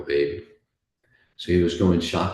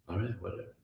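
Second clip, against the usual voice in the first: resigned; 13 dB quieter, much faster than usual. Acted. Dog accepts being killed uncomplaining.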